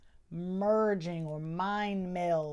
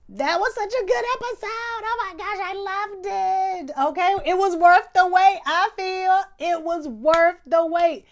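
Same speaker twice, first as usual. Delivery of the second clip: high-pitched, loud excited gush; loud, squealing enthusiasm; 11 semitones higher, 11 dB louder than usual. Real, not acted. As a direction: rave about it at the top of your range. Gushing over the podcast episode.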